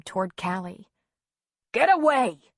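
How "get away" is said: high, loud angry order; hollering. A narrator's reading. Father driving child from mother, angry holler. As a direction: holler to drive them off. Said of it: "Griff hollered".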